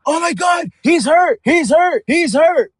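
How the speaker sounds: high, loud excited yell; retold scream. Watching the fight with dad, screaming at the TV, loud. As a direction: shout it like the fighter just got rocked. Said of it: "I screamed out".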